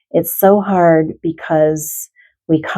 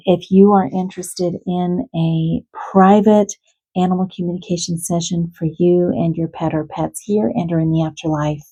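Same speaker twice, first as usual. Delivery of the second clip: ordinary talk, nothing marked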